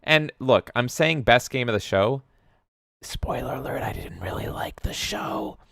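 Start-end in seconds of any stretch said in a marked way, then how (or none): 3.0-5.6 breathy secret whisper under the breath; whispered aside. Real, not acted. Streamer confides to chat, whispering. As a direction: let it slip as a quiet confession.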